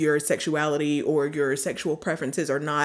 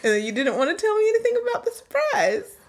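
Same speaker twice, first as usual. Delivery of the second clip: much higher exaggerated whiny imitation; squeaky teasing copy of her partner; mocking partner voice; faster than usual. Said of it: "I don't sound like that".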